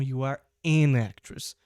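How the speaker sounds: slow, "an" joined to "actress"; stress on an. Shown as a demonstration. Teacher repeating the vowel example slowly. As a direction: stress "an" gently. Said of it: "A atriz começou com A".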